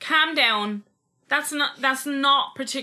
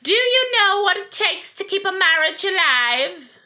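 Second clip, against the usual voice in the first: high, loud, slow royal impression; drawn-out, haughty monarch caricature; the Queen; 7 semitones higher, 5 dB louder, slower than usual. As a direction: lecture grandly in a put-on regal voice. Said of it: "I'm really good at doing the Queen".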